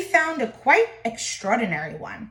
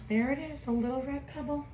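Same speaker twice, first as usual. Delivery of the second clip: hushed aside; 2 semitones higher, 9 dB quieter, faster than usual. Shown as a demonstration. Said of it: "aside pointing at picture".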